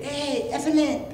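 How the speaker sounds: high, drawn-out Mexican rabbit voice; Mexican accent. Acted. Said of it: "It was a Mexican rabbit".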